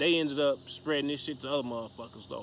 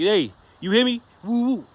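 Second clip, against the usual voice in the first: raised voice trying to get attention; retold holler, higher and louder; calling out; 5 semitones higher, 7 dB louder than usual. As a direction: call down the phone to someone not listening. Retold calling to friend on phone.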